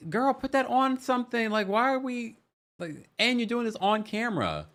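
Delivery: high, incredulous scolding; sassy inner voice. Retold or imitated. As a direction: tell her off in your head. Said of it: "And I'm thinking to myself".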